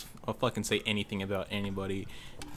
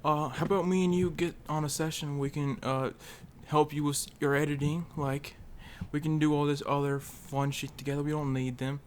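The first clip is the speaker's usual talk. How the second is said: higher-pitched mock-friendly voice; raised, ingratiating pitch, put on; super nice guy; 5 semitones higher than usual. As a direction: imitate a phony nice guy making an offer. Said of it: "He's gonna be, like, this super nice guy".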